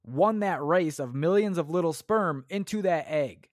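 ordinary talk

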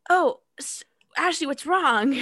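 high mock Jimmy voice; high, concerned. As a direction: ask worried and high. Jimmy quote.

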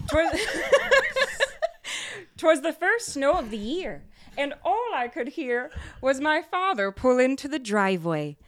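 high, rhyming storybook reading; Christmas story narration. Acted. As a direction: read it like a Christmas poem. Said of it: "tell it as, like, a Christmas story".

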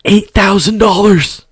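high, drawn-out imitation of a manager; staggering price announcement. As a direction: stretch out the price in a put-on high voice. Acts out manager quoting shocking price.

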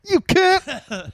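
high, furious insult; Jim Cornette swearing tirade. Retold or imitated. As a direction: spit out the insult. Continuing Cornette impression.

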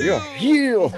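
high, loud preacher shout "heal"; shouting faith healer. Retold or imitated.

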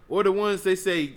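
normal talking voice

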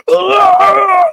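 loud, very high-pitched wail; wailing scream. Retold or imitated. Patient as his tooth gets pulled, wailing scream. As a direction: scream your head off. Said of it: "wailing. I was like".

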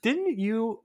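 ordinary talk

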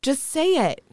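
high, playful retold urging; pleading egging-on. Begging friend's English mom, high and playful.

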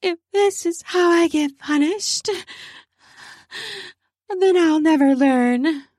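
high breathy playful reply. Acted. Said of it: "her own voice breathy as she replied".

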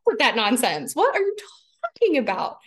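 hammed-up indignation, voice jumping high on hell; mock indignant outrage. Mother voicing her outraged inner self at raging child, mock indignant. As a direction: play the affronted part of her, half-laughing.

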